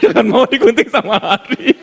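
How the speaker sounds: teasing through laughter; laughing. Real, not acted. Laughing as he teases Rosa in the congregation. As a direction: tease her while giggling.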